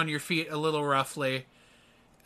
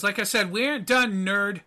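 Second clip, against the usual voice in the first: higher-pitched, irritated put-down; dismissive; 6 semitones higher, 3 dB louder than usual. Acted. Annoyed heroine dismisses the wayward teleporter, dismissive. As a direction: shut the nerd down. NPC brushes off the player.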